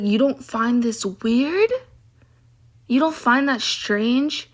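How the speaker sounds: slow, drawn-out question rising at the end; incredulous questioning. Retold or imitated. Woman confronting coworkers who eavesdrop on her calls, incredulous. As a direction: stretch it out in disbelief, pitch climbing.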